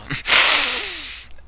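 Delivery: sudden high cackle; real laughter bursting out; loud burst of laughter. Real, not acted. Laughing over his crush.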